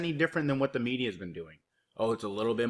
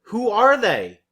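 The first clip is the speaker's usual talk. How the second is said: loud, higher sneering echo of the phrase; sarcastic mock outrage; 7 semitones higher, 11 dB louder than usual. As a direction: throw the phrase back, mocking and loud. Mocking the quoted phrase.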